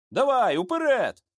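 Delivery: high-pitched order, no shout; mild soldierly command; urging command. A narrator's reading. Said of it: "скомандував Яків".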